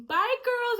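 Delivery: high, loud singsong farewell; imitating Auntie's goodbye. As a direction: sing out the goodbye like a cheerful aunt. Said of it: "Auntie just waving".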